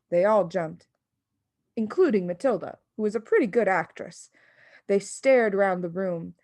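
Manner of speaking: ordinary delivery, nothing marked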